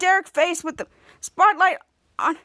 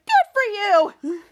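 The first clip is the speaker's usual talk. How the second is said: very high, louder squeal; acted cheer; 4 semitones higher, 3 dB louder than usual. Shadow cheers Pierre walking out, high-pitched squeal. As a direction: squeal it out as congratulations.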